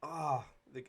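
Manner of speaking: usual speaking voice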